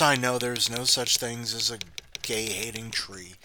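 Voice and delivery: ordinary delivery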